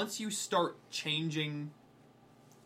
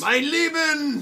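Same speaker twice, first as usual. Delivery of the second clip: loud, drawn-out German soldier cry, higher; grainy game voice; 11 semitones higher, 10 dB louder, much slower than usual. Retold or imitated. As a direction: shout it like an old game sound sample. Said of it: "the awkward, grainy voices".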